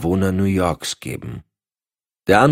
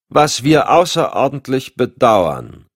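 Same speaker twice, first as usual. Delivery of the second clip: slow, louder, higher interjection; emphatic, slow displeasure; pointed regret; 8 semitones higher, 6 dB louder, slower than usual. A narrator's reading. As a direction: cut in with heavily stressed disapproval. Rival agency objects to ranking.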